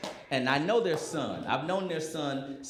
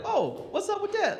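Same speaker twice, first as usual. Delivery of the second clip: much higher, playful mock curiosity; mock interested. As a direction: act overly interested, pitched way up. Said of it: "And I was like".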